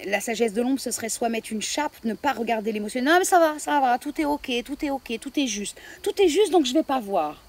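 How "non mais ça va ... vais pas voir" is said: high, breezy brush-off insisting all is fine; airy mock reassurance, pitched high; dismissive denial. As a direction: wave it away like nothing is wrong. Voicing an inner denial of emotions.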